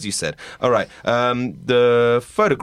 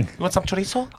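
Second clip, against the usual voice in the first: waiter pushing chorizo; 7 semitones higher, 5 dB quieter than usual. Acted.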